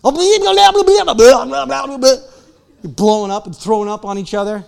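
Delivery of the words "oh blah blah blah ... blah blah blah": high, loud gushing blah blah; mock gossip gush. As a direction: pile on with delighted gossip. Friends gossiping in the ditch.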